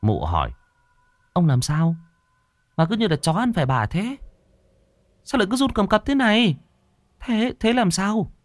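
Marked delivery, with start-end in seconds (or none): 1.3-8.3 high-pitched, anxious wife voice; fretful wife. Acted. Wife pestering her trembling husband, high and anxious. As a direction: press him with a high, worried nag.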